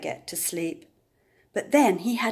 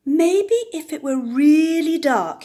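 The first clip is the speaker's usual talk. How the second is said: excited sheep voice; 6 semitones higher, 8 dB louder than usual. Acted. Russell's thought voiced loudly.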